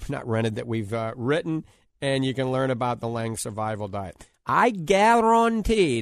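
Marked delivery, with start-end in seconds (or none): 4.5-6.0 slow, drawn-out New Orleans guarantee; New Orleans accent. Real, not acted. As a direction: let the New Orleans accent slip out, slowly. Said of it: "that's my New Orleans accent coming out".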